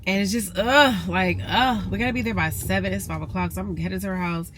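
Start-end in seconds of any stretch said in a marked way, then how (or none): 0.6-1.1 frustrated groan. Real, not acted. Frustrated about evening plans.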